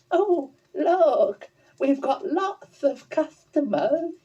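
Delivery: high, eager little llama voice; bubbling over with excitement as a young llama; high excited llama character voice. Acted. Lorenzo sees customers.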